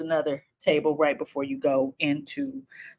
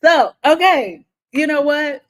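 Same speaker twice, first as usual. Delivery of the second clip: fed up; 8 semitones higher, 9 dB louder than usual. Retold or imitated. Reacting to the insult.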